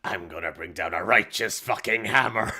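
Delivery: gruff Morrowind elf guard voice; Dark Elf voice. Retold or imitated. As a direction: growl it like a Dark Elf Ordinator. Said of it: "Dark Elf voice for Morrowind".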